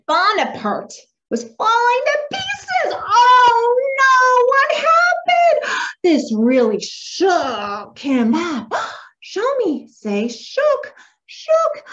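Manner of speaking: exaggerated, high, drawn-out storytelling for a child; over-the-top amazement; really excited. Shown as a demonstration. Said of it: "I'm gonna be making myself really excited".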